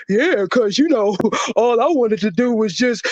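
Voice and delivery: mock someone with phlegm in their throat; congested, phlegmy mocking imitation; mucus-throated mockery. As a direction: talk as if mucus clogs your throat. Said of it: "sounding like he got fucking mucus and shit all in his goddamn throat".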